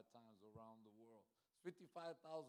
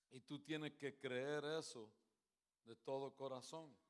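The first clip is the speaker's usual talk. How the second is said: rising, louder preacher's appeal; preaching; 2 semitones higher, 6 dB louder than usual. Acted. Preacher urging the congregation to believe, fervent. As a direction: press it on the crowd like a pastor.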